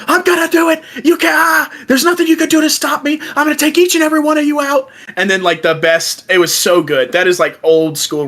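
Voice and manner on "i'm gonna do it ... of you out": high, fast furious vow; angry shouted vow. Retold or imitated. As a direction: spit it out, mad and unstoppable. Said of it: "he's mad".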